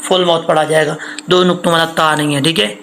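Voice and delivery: ordinary delivery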